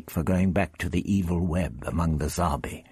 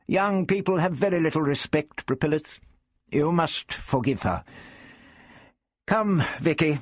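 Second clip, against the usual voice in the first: put-on high-pitched old gentleman; the Doctor, lofty; 11 semitones higher than usual. A narrator's reading. The Doctor apologises for Barbara to his rival, loftily. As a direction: pronounce it high and superior, then summon. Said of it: "the doctor called".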